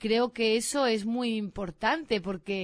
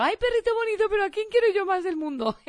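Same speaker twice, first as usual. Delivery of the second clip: put-on much higher, gushing baby voice with a laugh; doggy baby talk; 11 semitones higher, 4 dB louder than usual. Owner greeting the dog at home, gushing high baby talk. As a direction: melt into cooing for the dog. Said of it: "y al perro".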